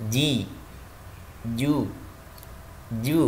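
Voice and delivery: "ji, ju" in separate syllables without air escaping; breath held. Shown as a demonstration. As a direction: isolate "ji" and "ju", holding back breath. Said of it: "nafas tertahan". ("ji, ju" dengan nafas tertahan)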